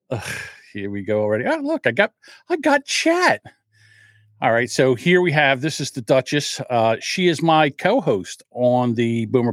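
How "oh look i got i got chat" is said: high excited surprise; suddenly noticing chat, pitched up; surprised. Real, not acted.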